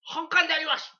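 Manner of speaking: high, loud, declared line; mock announcement. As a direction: boom it out like a mock officer. Playful impression, police line. (警官をまねて高く大きく宣言する)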